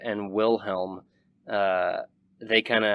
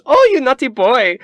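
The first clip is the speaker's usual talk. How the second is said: very high, loud scandalized squeal; mock-shocked flirty aristocrat; Wilhelm's voice; 14 semitones higher, 10 dB louder than usual. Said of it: "And Wilhelm says".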